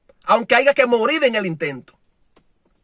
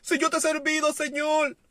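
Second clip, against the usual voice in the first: high, pleading whine to the Lord; whiny complaining believer; 6 semitones higher, 4 dB quieter than usual. Retold or imitated. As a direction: imitate a self-pitying complaint, voice up high. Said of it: "en vez de decir".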